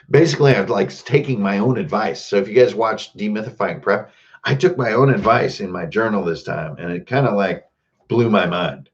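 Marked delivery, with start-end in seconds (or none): none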